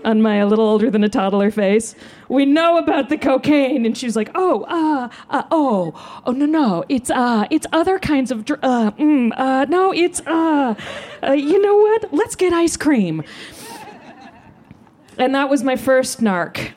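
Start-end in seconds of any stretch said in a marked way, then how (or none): 2.3-3.9 high-pitched kid playing it cool; mock child accuser, less squealed; cool toddler cop. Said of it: "I like to imagine aviator sunglasses". 4.4-13.2 high, stammering backpedal; mock panicky mother, pitched up and halting; flustered mom. Mom scrambling to change subject.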